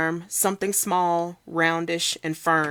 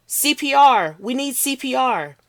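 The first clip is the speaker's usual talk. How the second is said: higher, louder yell for help; urgent shout; 8 semitones higher, 6 dB louder than usual. Acted. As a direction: call out to the crowd. Bystander calling for help.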